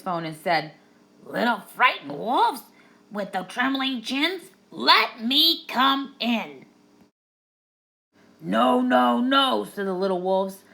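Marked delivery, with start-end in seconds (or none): 1.2-6.7 slow, higher, sly pig voice; big bad pig. A narrator's reading. Pig on the video phone, slow sly menace. 8.4-9.6 louder, higher wolves' refusal; little wolves. A narrator's reading. Wolves refusing the pig, loud and high.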